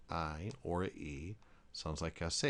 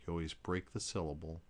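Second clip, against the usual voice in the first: ordinary delivery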